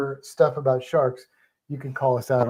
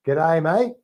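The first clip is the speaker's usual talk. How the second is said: teacher modelling an Aussie accent; Australian accent with rising end, for repeating; 3 semitones higher, 3 dB louder than usual. Shown as a demonstration. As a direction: demonstrate a broad Aussie greeting. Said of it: "say it in an Australian accent".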